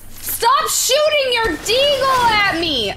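high loud slow complaint; yelling. Real, not acted. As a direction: yell at the teammate. Yelling at teammate in game.

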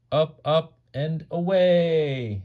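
high, slow, drawn-out liftoff call; Santa taking off. Acted. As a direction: sing out the sleigh launch. Santa takes off in story.

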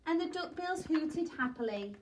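ordinary delivery

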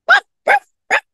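high yappy barks, ruff ruff; dog barking. Acted. Reading the dentist's dog barking at the alley, barking. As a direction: bark like a little dog. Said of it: "began to bark".

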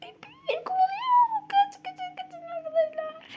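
high panicked cry; frantic squeal while fleeing; being chased. Real, not acted. Chased by Pepe in game.

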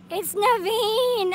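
delighted discovery. Real, not acted. Names frog after Disney prince.

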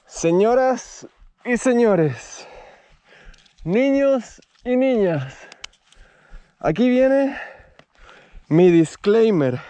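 slow grand ringmaster announcement. Acted. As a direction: drawl it out like a circus ringmaster. Announces like a showman.

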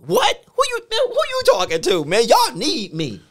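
high, loud indignant outburst. Retold or imitated. Pastor scolded by the executive pastor, indignant outburst. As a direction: huff up at the boss. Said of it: "I got angry when he said it".

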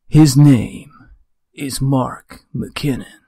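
slow, dramatic trailer narrator; movie trailer voice. Acted. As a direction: intone it like an epic film trailer. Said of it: "the speaking with a movie voice edition".

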